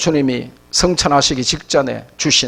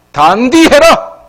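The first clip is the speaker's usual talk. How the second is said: high, loud, drawn-out exhortation; forceful admonition; 7 semitones higher, 6 dB louder, slower than usual. Acted. Preacher admonishing the witnesses, high emphatic Gyeongsang command. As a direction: drive it home loudly, stretching the words.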